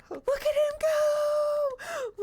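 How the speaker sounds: very high, drawn-out cheer; squealing slow coo. Real, not acted. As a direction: squeal with delight as he runs. Cheering on the running hamster.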